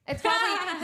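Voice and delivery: words breaking into a laugh. Real, not acted. Friends joking about their fearless brains, laughing. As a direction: laugh through it at the joke. Laughing at own amygdala joke.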